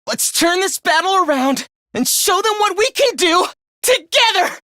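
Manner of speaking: high, spirited battle cry; rally the team with rising pitch; rousing rally. Acted. Fighter urging friends to turn the battle around, rousing.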